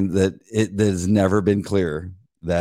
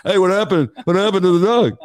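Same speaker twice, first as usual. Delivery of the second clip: mock taunting rivals; 10 semitones higher, 4 dB louder than usual. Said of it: "the dudes are laughing at you".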